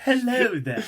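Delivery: high mock-posh English; hammed-up posh English greeting; upper English accent. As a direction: pitch it up into a silly upper-class English voice. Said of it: "it's supposed to be upper English".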